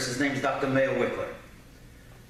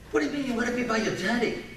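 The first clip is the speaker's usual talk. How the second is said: higher, quick, baffled questioning; lightly voiced puzzled mother; 7 semitones higher than usual. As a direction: mimic a mother questioning her son. Voices mother questioning crying boy.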